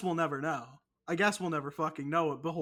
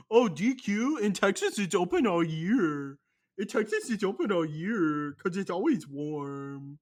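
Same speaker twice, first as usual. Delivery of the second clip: mock Texan bragging; 2 semitones higher, 6 dB louder than usual. Mocking his cohost's Texas bragging, hammed-up boastful voice. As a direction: brag about Texas like a goofy caricature. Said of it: "in that exact voice".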